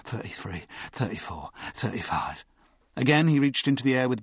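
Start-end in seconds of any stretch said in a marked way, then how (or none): 0.0-2.4 low, soft private count; counting to himself. A narrator's reading. Ager keeping count during the drill, low murmur. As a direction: murmur the numbers under the breath. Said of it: "counting to himself".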